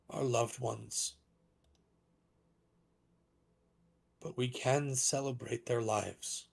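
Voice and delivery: measured proclamation with long pauses; lightly voiced dragonborn orator, halting and grave; solemn public address. Dragonborn councillor addressing mourners at a vigil, solemn proclamation. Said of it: "very loud, probably magically amplified voice".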